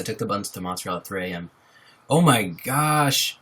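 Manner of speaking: ordinary delivery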